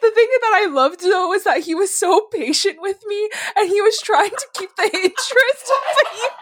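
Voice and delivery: high, laughing through embarrassment. Real, not acted. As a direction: laugh while telling it.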